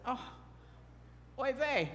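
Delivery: ordinary delivery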